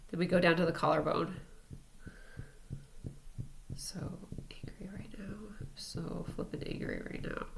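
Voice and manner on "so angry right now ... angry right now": hushed, breathy whisper of the anger phrase; whispered mantra. Shown as a demonstration. Tapping the collarbone, venting anger in a hushed whisper. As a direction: whisper it so coworkers in the next room can't hear.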